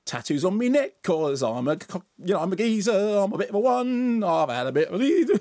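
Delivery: high, sing-song cockney parody; mock cockney geezer. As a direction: ham it up as a London geezer. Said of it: "it's not just the voice".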